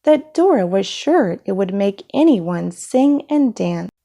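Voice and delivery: usual speaking voice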